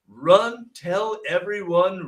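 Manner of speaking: slow, punched-out comic command; mock urging. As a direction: spell out the order she supposedly heard. What the nurse supposedly heard.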